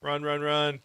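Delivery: hurried and urgent, fleeing; being chased. Real, not acted. Being chased by purple mob.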